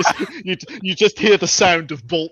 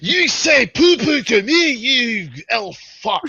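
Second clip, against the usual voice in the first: angry dwarf; 4 semitones higher, 3 dB louder than usual. Acted. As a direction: roar the threats, dragging each word. Dwarf insults elf.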